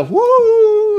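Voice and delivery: high, loud drawn-out "woo"; long sustained mocking hoot. Preacher answering the doubter of God, mocking woo.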